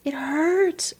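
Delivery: softly wailed acted grief; pained mourning. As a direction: let the hurt swell on the word. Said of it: "the only proper response to a painful thing".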